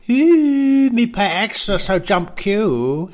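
high, excited broken English, slowing at the end; mock Kim voice. A pal mimics Kim, high and pleased. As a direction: ham up the dictator bragging.

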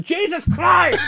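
high, drawn-out mock shout; brother yelling Jesus Christ. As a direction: yell it like a stubbed toe. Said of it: "The way his brother was saying".

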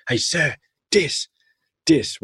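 put-on a joyful kid drawn out mid-dance; kid saying dis. Teacher imitates the dancing boy calling "dis" slowly and high. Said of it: "he couldn't pronounce the T H".

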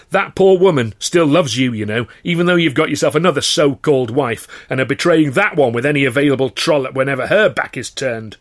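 loud and high; furious accusation. Acted. Actor raging at his cheating boss, furious. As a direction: hammer him with it.

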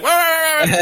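high, loud playful rah rah; mock battle roar. Host imitating Ozzy yelling in the promo, high mock roar. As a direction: mimic a rocker flailing at a virtual dinosaur. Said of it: "he's just like".

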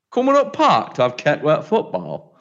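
lightly put-on Yorkshire accent, slow; Yorkshire impression. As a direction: mimic a Yorkshire kid's accent, unhurried. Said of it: "that's the only sentence I can say".

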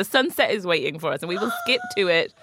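giggly playful reply; laughing. Real, not acted. Joking about happily ever after.

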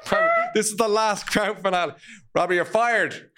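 high, hammed-up exasperated rant; mock outrage. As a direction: play furious boss sacking a helper. Acted rant at friend, sacking him.

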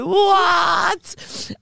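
a high, shouted "What?" in disbelief; shocked what. Retold or imitated. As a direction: yelp it as if you can't believe the link. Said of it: "I was like".

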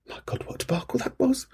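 whispered, breathless groan; whispered exasperation. Real, not acted. Host remembering the app outage, whispered. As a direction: breathe it out in dismay.

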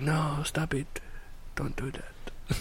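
soft, fading mock plea; weak pope. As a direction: feeble protest, trailing to a whisper. Weak pope voiced from bed.

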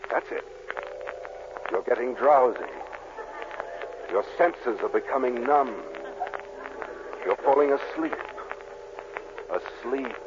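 hypnotist's lull. Acted. Doctor putting Dennis under, lulling drone.

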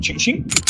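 two quick whispered clicks of a pistol; gun chambering noise. Retold or imitated. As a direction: click it like a slide racking. Imitates gun being chambered.

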